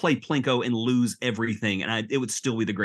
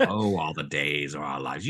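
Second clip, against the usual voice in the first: soap opera announcer; 7 semitones lower than usual. Retold or imitated. Soap opera title imitation.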